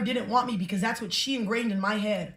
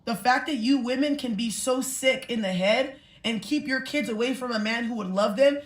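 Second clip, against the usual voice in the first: ordinary talk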